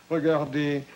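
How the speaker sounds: ordinary delivery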